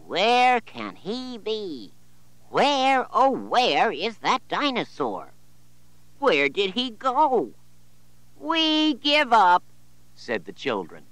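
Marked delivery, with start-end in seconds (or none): 0.1-7.5 pretend searching. 8.4-9.6 put-on high child voice.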